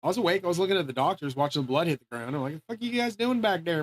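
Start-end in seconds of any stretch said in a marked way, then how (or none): 2.5-3.8 higher-pitched indignant question; retold alarmed protest. Patient retelling his challenge to the doctors, alarmed protest. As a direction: re-enact startled protest, pitch lifted.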